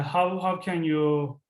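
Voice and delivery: ordinary talk, nothing marked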